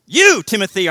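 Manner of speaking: You, Timothy shouted high and loud; stress on You. Shown as a demonstration. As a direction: slam the word You down hard. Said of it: "it's given an emphatic position".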